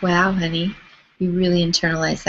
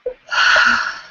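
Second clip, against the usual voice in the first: big breathy exhale; dramatic sigh; 4 dB louder than usual. Shown as a demonstration. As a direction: release a long, unvoiced sigh. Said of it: "big deep breath, big sigh".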